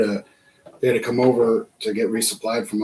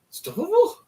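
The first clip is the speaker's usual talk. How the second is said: surprised recognition. Retold or imitated. Recognizing his sergeant.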